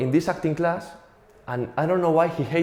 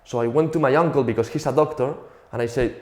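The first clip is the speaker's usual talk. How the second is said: normal talking voice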